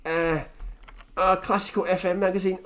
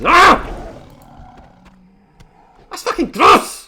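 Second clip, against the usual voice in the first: loud, high, slow grossed-out shout; disgusted yell; 9 semitones higher, 10 dB louder, slower than usual. Acted. Recoiling from granny's kiss.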